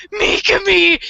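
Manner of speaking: high tearful mock call for help; hammed-up wail of a helpless man; imitated crying out. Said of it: "he's crying to Mikami".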